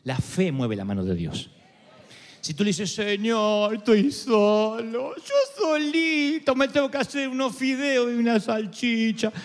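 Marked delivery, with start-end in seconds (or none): 3.0-9.3 high, slow whiny plea, mocking the self-pitier; mock self-pitying prayer. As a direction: pray like a spoiled child feeling sorry for himself.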